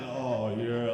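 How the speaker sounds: low, lecherous "oh, yeah"; creepy leer. Retold or imitated. Comic mimicking Shaq ogling women, low and leering.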